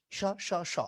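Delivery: স said as "sho", the same each time; Bangla sh sound. Shown as a demonstration. Teacher shows the three Bangla s letters sound alike, all sh. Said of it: "তিনটা সয়ের Bangla pronunciation একই রকম".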